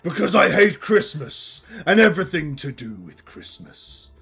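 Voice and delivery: theatrical villain monologue with deliberate pauses; bitter villainous curmudgeon. Acted. Curmudgeon explains the plot against Christmas, menacing monologue. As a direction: spit out the hatred, then recount it ominously. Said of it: "I am the Christmas curmudgeon".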